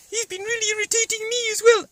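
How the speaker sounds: a high cartoon pet voice; Grippy the dog puppet. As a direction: squeak it out like a little dog puppet. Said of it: "that sounds like Grippy there".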